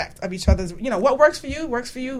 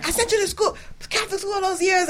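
high-pitched imitation of a Jamaican mother; Jamaican accent; 11 semitones higher than usual. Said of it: "what accent I'm doing".

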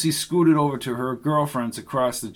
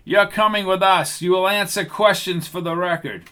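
louder, higher hard command; an agent seizing a girl; stern arrest order; 6 semitones higher, 4 dB louder than usual. Acted.

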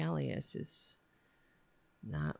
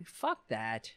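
ordinary talk, nothing marked

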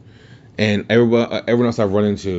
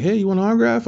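much higher, light mock female voice; a playful high-pitched impression of a friendly wrestler; imitating Toni Storm; 13 semitones higher, faster than usual. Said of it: "And she's like".

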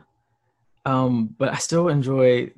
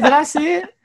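high, loud indignant quote; how dare you ask; mock offended; 4 dB louder than usual. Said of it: "I was like".

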